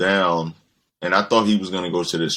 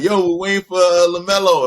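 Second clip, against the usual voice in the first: put-on higher, eager kid voice; 10 semitones higher, 4 dB louder than usual. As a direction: play the waiting kids, pitched way up. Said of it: "They were like".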